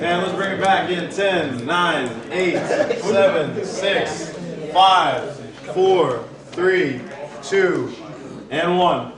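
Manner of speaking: loud, projected countdown over a chattering room; calling out a countdown. Real, not acted. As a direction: call the group back to attention. Calling group back with countdown.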